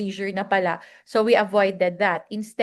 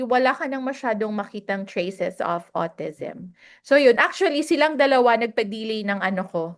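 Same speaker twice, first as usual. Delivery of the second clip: ordinary talk, nothing marked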